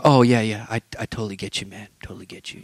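low, very soft placating mumble; noncommittal agreement. Retold or imitated. People covering fear with excuses, quietly placating. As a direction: trail off into hushed, easy agreement.

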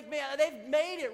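ordinary talk, nothing marked

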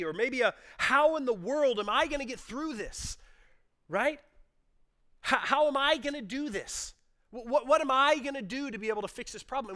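high, loud, driving sermon voice; preaching at full force. Acted. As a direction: build the anxious questions to a peak. Preaches the what-if questions.

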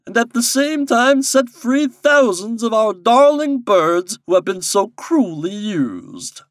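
high, loud, slow royal proclamation; commanding king. A narrator's reading. King instructing Popopo in his duty, imperiously. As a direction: declaim the orders grandly, drawing words out.

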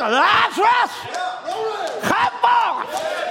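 high ringing shout, drawn out; commanding call. Acted. Christ calling Lazarus from the grave, commanding shout. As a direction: call the dead man out of the grave.

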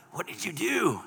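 cough, then recoil in mock dismay; choking disgust. Bystander reacting to someone drenched in cologne, choking disgust.

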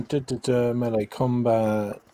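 usual speaking voice

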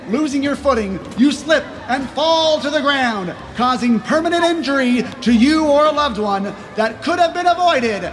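pitchman lecturing a doubter; indignant carnival barker. Acted. Seller rants back at customer.

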